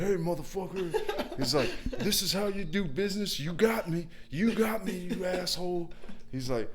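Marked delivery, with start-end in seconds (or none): 0.0-1.4 high-pitched mock yell with a laugh; imitating angry customer. Irate customer cursing contractor on the phone, mock angry yell. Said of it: "angry on the phone, yelling, cursing at me". 2.0-5.9 much higher-pitched mock yelling; imitating angry customer. Customer berating contractor over the mess, high mock-angry rant. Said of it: "angry on the phone, yelling, cursing at me".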